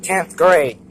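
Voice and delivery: exasperated shout. Real, not acted. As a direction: yell "Tenth grade" in disbelief.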